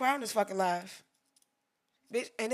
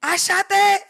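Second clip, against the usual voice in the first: thrilled; 10 semitones higher, 15 dB louder, slower than usual. Real, not acted. Marveling at her own photo.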